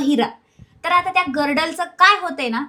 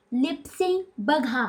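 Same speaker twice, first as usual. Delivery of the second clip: लिप sync बघा drawn out slowly and softly, every syllable shaped; slow for lip reading; 5 dB quieter, much slower than usual. Shown as a demonstration.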